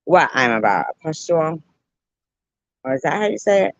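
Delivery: usual speaking voice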